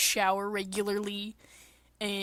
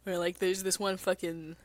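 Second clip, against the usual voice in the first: ordinary delivery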